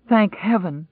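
high, choked cry of relief; tearful relief. Acted. Woman greets the survivors, high choked relief. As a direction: gasp it out near tears. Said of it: "smiling and crying at the same time".

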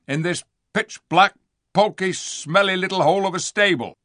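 high, loud, grumbling dwarf voice; disgusted dwarf. A narrator's reading. Diggle describing the stable, disgusted. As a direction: spit out each complaint.

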